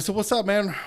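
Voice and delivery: high, upbeat greeting; chummy and curious; bright casual question to new worker; friendly. Retold or imitated. Casual chat with new hire.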